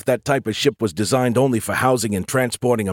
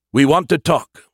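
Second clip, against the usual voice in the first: firm announcement; 5 semitones higher, 5 dB louder than usual. A narrator's reading. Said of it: "he announced".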